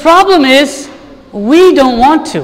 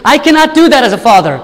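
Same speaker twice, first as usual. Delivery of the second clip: ordinary talk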